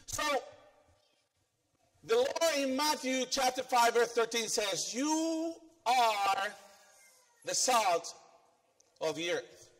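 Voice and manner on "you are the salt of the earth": normal talking voice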